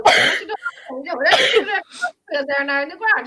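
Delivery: high, tumbling Irish country gabble; mock Irish accent, words running together; mimicked Irish country accent. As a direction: give directions in a thick rural Irish accent. Imitates incomprehensible country directions.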